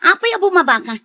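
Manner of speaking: sudden high, loud question; wife alarmed as husband steps out; surprised demand. Acted. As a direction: call out after him, startled. Wife questions husband leaving car.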